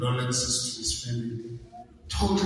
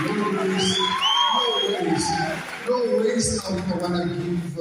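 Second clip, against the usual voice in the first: forceful refusal; 6 semitones higher than usual. Real, not acted. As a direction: slam the door on the idea. Refusing pardon for killers.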